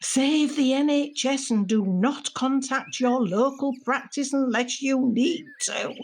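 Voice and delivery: mock official message. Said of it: "the message they're always spouting".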